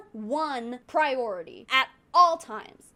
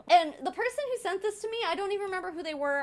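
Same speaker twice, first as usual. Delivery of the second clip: ordinary talk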